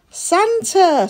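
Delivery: high loud hopeful cry; cat calling out, thinking Santa has come; excited call. A narrator's reading. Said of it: "Splat called".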